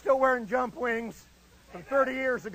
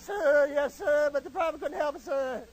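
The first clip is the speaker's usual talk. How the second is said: pleading recruit in a thin voice; Freeman Spears; 3 semitones higher than usual. Retold or imitated. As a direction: plead with the sergeant in a small voice. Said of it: "He talked just like that".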